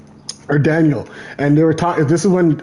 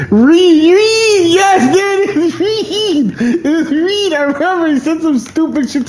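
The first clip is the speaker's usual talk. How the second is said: high, loud cry of the name Reed; excited recall; 11 semitones higher, 4 dB louder, slower than usual. Real, not acted. Finally remembering the name Reed, crying it out. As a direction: shout the name as it finally comes.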